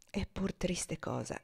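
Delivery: soft, slow, almost private; to herself. A narrator's reading. Eva musing softly to herself by the lake. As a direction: murmur it to yourself, gazing at the lake. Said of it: "come parlando a se stessa".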